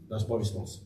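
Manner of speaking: low, quiet, understated reply; unresponsive. Shown as a demonstration. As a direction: drop it low and soft, no reaction. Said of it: "I don't respond to it".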